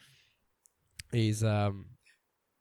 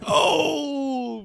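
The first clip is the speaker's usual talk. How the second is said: shocked cry; 12 semitones higher, 7 dB louder than usual. Real, not acted. Teased about his girlfriend.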